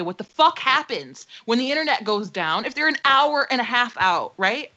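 ordinary talk

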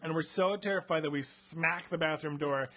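ordinary talk

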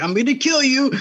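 high-pitched mock threat as Hiccup; playful impression of a boy's threat. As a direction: squeak out a pretend threat. Hiccup threatens Toothless, retold.

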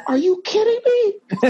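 high, slow, stretched amazement; high drawn-out mock disbelief; amazed disbelief. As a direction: act stunned by the news. Retold amazed reply.

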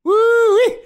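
high, loud "woo-wee"; big impressed cheer; excited whoop. Real, not acted. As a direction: whoop in delight at the performance. Dad cheers the loud demo.

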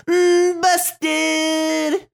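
high teasing "busted"; sing-song taunt. Pretend. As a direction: gloat like a kid on the playground. Taunting the caught suspect.

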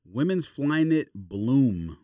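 normal talking voice

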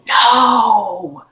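drawn-out yell of refusal; retold yell. Patient yells at the light with all her force, drawn-out yell. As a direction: yell a long, protesting no. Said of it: "I yelled with whatever force I had".

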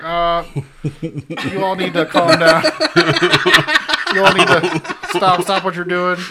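bullhorn announcement. Retold or imitated. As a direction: play Eugene calling through a megaphone. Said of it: "Eugene on the back with a bullhorn".

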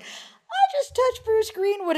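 very high, slow, giddy retold squeal; star-struck squeal. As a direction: draw out the thrilling news in a high voice. Tells fellow volunteer, star-struck.

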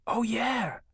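put-on high, delighted child's exclamation; light up as you suddenly remember; beaming little boy. A narrator's reading. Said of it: "His eyes beamed".

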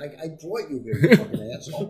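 sudden loud retold snap at a friend; mock angry outburst. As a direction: blast him like you're fed up. Retelling his angry comeback to Patrice.

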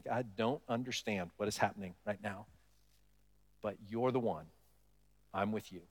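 low, soft, humble admission; hushed confession. Acted. Believer privately telling God of confusion and trust, hushed. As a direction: say it quietly, as a private prayer.

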